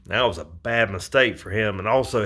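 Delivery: normal talking voice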